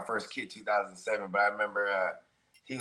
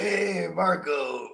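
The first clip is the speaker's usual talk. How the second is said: slow drawn greeting; 5 semitones higher, much slower than usual. Retold or imitated.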